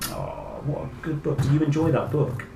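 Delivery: ordinary delivery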